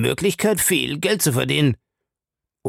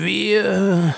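drawn-out, hesitating "äh"; stalled, groping "äh" after "wie"; helpless pause; 10 semitones higher than usual. A narrator's reading. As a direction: hang on "äh", searching helplessly for the word. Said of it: "Er machte eine hilflose Pause".

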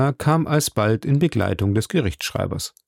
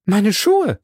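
much higher, slightly louder; surprised cry; 10 semitones higher, 4 dB louder than usual. A narrator's reading. Passepartout recognising his shoes in court, high surprised cry. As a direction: blurt it out in shock. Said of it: "rief voll Überraschung Passepartout".

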